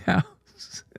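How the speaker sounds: ordinary talk, nothing marked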